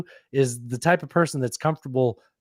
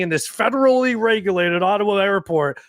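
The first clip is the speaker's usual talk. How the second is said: lightly mocking, raised pitch; mocking his words; 9 semitones higher, 3 dB louder than usual. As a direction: parrot his pompous phrase. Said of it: "he keeps saying".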